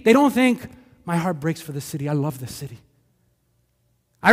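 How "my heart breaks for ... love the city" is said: soft, lower, heartfelt; tender. Retold or imitated. The ideal believer speaking of the city, soft and tender. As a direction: say it like you mean it gently.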